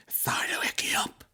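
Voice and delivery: unvoiced sheepish whisper. Drunk son whispering on the phone to his ma.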